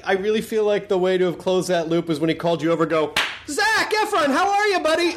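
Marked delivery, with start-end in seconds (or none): 3.5-5.2 high, hammed-up buddy greeting; hearty mock greeting. Suggesting how to greet the star, in a mock-jovial high voice. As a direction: call out to him like an old pal. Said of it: "Like that".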